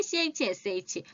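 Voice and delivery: usual speaking voice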